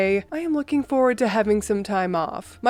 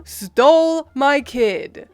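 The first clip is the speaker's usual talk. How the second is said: slow, louder, each word bitten off; seething repeat; 3 semitones higher, 5 dB louder, slower than usual. Retold or imitated. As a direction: drive it home word by word. Repeating accusation at mom.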